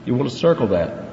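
usual speaking voice

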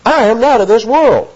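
"I" stressed high at the start; emphatic I. Acted. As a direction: hit "I" firmly, keep the pitch up. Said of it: "another emphatic pronoun".

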